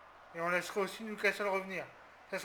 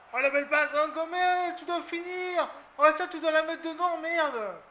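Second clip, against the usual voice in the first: much higher, much louder, anguished; frustrated excited yelling; 10 semitones higher, 12 dB louder than usual. Real, not acted. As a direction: scream at the missed chance. Occasion ratée de Gomez. (hurle de frustration, beaucoup plus aigu et fort)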